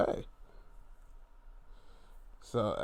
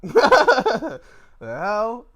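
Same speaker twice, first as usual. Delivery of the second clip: laughing; 8 semitones higher, 13 dB louder than usual. Real, not acted. Laughing at wrestling moment.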